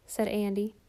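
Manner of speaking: plain reading voice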